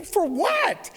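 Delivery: high-pitched, indignant squawk of disbelief; incredulous dad, voice shooting way up. Retold or imitated. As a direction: act out being floored by the demand. Acted shock at ex-wife's demand.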